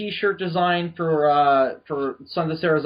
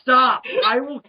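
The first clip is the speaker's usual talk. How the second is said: loud, high exasperated outburst; mock threat; 7 semitones higher, 4 dB louder, slower than usual. Host threatening teasing co-hosts, mock fury.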